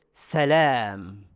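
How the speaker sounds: "Salam" with an open a out of the mouth. Shown as a demonstration. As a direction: send "Salam" forward through a wide mouth. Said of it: "Suara mulut".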